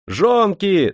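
high, carrying call; ringing girlish shout. A narrator's reading. Girl summoning the factory women, ringing shout. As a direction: call out to the crowd in a ringing young voice. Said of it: "закричал звонкий девичий голос".

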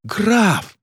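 very high, wailing "Граф"; horrified cry. A narrator's reading. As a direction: shriek the title in mock-tragic shock. Countess cries out in horror.

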